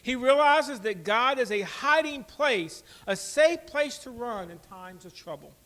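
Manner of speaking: loud, slow sermon emphasis; preaching. Acted. Pastor assuring the congregation, slow loud preaching. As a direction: declare it slowly to the room.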